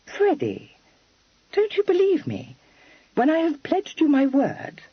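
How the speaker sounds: high, pleading reproach; reproachful. A narrator's reading. Kitty reproaching Freddy for doubting her, high. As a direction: gently reproach him. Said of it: "and said reproachfully".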